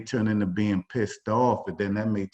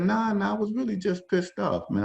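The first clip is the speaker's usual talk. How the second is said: high, casual mock denial, waving it away; a light retold shrug of a protest; brushing it off; 12 semitones higher than usual. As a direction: play down the anger like it's no big deal. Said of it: "I said".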